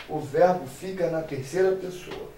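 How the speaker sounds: normal talking voice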